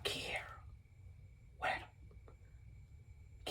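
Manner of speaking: halting, barely there whisper; hushed question, trailing into silence; secretive whisper. Retold or imitated. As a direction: whisper it in pieces, furtively. Secretly asking friend about contraband.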